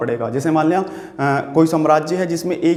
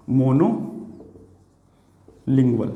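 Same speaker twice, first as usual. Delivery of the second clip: "Monolingual" in two parts, long pause after "mono"; lower, drawn-out "monolingual", broken for note-taking; slow dictation; 4 semitones lower, much slower than usual. Shown as a demonstration. Teacher writing out the new word for students, slow dictation.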